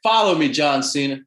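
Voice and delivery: calling out loud and high; loud plea. Real, not acted. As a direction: shout it up to a celebrity. Plea to celebrity on air.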